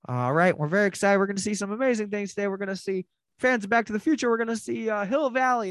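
high, fast, peppy tour guide announcement. Retold or imitated. Tour guide greeting the tram riders, high and peppy. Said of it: "the tour guide comes on thing and is like".

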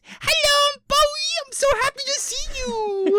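very high, loud, gushing dog voice; Bowie. Shown as a demonstration. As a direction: squeal like an overjoyed pet meeting someone. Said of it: "This is Bowie's voice".

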